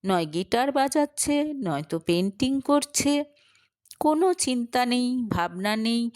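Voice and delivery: usual speaking voice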